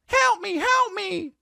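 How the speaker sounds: very high, slow squeaky plea; mock helpless falsetto; tiny trapped character. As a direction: squeak for help like a cartoon. Trapped character in kids' show.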